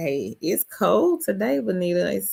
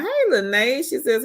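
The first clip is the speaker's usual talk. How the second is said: normal talking voice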